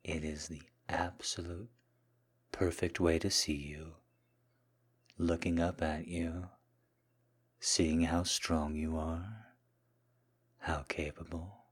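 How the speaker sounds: soft, hushed, lingering murmur; submissive seduction. Acted. Kneeling man serving his Mistress, soft seductive submission.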